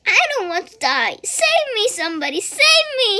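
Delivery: very high, loud terrified pleading; panicked screaming. A narrator's reading. Terrified pig screaming for someone to save him. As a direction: scream for help in terror. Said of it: "screamed the Wilbur".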